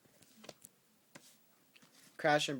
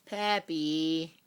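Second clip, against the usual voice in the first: higher, louder call across the room; calling the pet; 5 semitones higher, 7 dB louder than usual. Real, not acted. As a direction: call the dog's name out loud.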